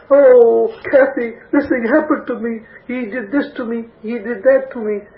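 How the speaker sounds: high, whiny imitation of a sobbing complaint; mock bawling to a friend. Moaning on the phone to friend Kathy, mock sobbing whine. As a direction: play the self-pitying caller moaning to a friend. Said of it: "bawling my eyes out".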